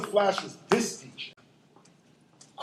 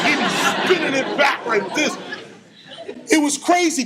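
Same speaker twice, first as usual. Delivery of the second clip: normal talking voice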